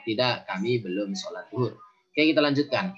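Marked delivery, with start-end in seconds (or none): none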